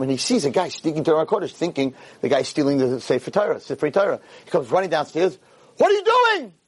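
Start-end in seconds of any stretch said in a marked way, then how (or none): 5.8-6.5 high, loud accusing shout; acted outraged rabbi; angry challenge. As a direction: confront a thief caught in the act. Rabbi catches suspected thief.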